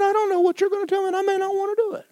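very high put-on voice, rushed; aggravated protest. Telling the Lord he may refuse, protesting. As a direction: act out a reluctant, high-pitched objection. Retelling his argument with the Lord.

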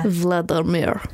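Russian accent. Shown as a demonstration. Demonstrating for co-host to copy, light Russian accent. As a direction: show how a Russian might say it. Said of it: "the name Vladimir, 'cause that's, that sounds Russian".

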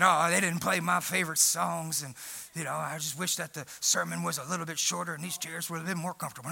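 low, soft, sulky grumbling voice, far below his own; mock the whiner in a low, muttering moan; grumbling church member. Pastor mocking a grumbling churchgoer, low sulky mumble. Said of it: "We're grumbling".

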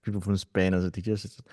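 ordinary talk, nothing marked

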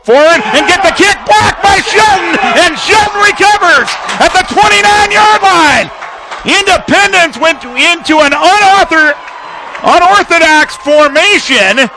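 excited call. Real, not acted. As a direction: burst out as the punt gets blocked. Blocked punt, live call.

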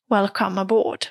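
ordinary delivery

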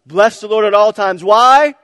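rally the crowd with a yell; loud call and response. Real, not acted. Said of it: "let's do it one more time".

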